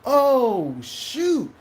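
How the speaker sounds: loud high oh, then shoot; learning the news, a startled oh shoot. Retold or imitated. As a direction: caught off guard, sharp rise. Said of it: "I was like".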